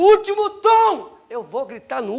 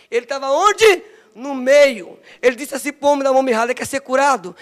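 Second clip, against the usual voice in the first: builds louder to a shout at the end; preaching at full force; 7 dB louder than usual. Acted. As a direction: drive it home to the congregation. (pregação crescendo até gritar)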